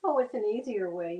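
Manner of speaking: usual speaking voice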